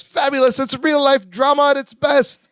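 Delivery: high, showy TV host impression; Robin Leach. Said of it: "Robin Leach voice".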